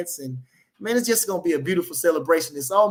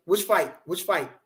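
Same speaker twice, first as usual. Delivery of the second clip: ordinary talk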